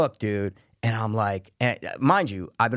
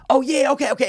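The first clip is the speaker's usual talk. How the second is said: parking lady; 12 semitones higher, 5 dB louder than usual. Retold or imitated. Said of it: "And she's like".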